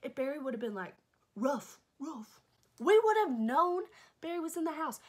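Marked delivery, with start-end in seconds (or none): 1.4-2.4 imitated bark. Imitating the dog. 2.8-5.0 much higher and louder, near shouting; exasperated outcry. Real, not acted. Babysitter insisting the dog should have barked, loud outcry.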